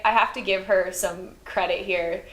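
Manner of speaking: ordinary talk, nothing marked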